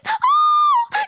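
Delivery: high, loud drawn-out "ohh" of fake crying; mock crying wail. Mocking the woman who now cries, high loud fake wail. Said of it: "now she cry".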